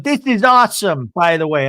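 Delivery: high, loud mock enthusiasm; Seth, enthusiastic. Seth greeting the group, high loud gushing. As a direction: gush loudly, imitating an over-keen husband. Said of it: "Seth goes".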